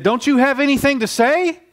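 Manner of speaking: acted-out demand, pitched high; incredulous questioning. Pilate questioning Jesus before crucifixion, high and incredulous.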